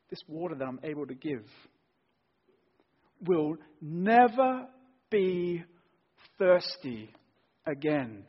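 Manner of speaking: slow, loud, drawn-out "will never be thirsty again"; emphatic never. Shown as a demonstration. Preacher stresses Jesus's promise to the congregation, slow and loud. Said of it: "the strongest way you can say never".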